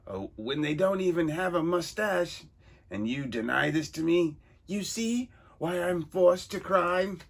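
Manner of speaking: high, loud theatrical villain; pompous villain voice. Acted. Reader voicing the villain, loud and high. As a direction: ham up a vain comic-book crook.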